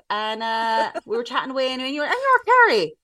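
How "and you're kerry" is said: quoted caller. Retold or imitated. Imitating caller's question.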